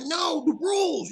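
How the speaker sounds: high, slow mock shout insisting on the rules; indignant protest. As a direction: yell it out like you're fighting for your spot. He protests being pulled offstage.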